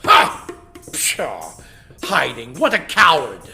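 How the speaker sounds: loud scoffing bluster; king mocking the hiding rival; scornful lion.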